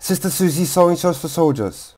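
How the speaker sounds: building from brisk to very fast; speeding up. Shown as a demonstration. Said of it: "faster and faster".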